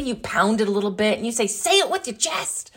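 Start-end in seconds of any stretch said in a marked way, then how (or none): 1.5-2.7 high, punchy catchphrase; bold and confident. Acted. Host shows listeners how to say it, bold and confident. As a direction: pound it out with swagger, pitched high. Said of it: "wanna be bold and confident".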